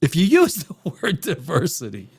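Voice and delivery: ordinary talk, nothing marked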